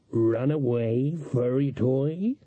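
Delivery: very slow, drawn-out feline taunt; cat-man purr. A narrator's reading. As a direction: toy with prey like a cat, stretching each word. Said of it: "he suggested".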